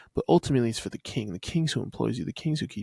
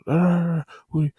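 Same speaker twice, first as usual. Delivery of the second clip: louder, higher mock royal outburst; king yelling; 6 semitones higher, 5 dB louder than usual. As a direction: bellow like an angry king. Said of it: "He's yelling".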